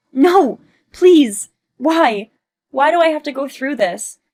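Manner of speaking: high, loud begging; desperate pleading cries. Acted. Olivia begging her friend for help before facing her husband, desperate. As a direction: plead in despair, voice straining.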